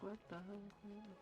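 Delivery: usual speaking voice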